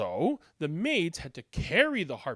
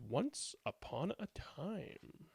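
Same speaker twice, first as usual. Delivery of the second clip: storybook opening; 2 semitones lower, 15 dB quieter, slower than usual. Shown as a demonstration. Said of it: "better to start a story like this with".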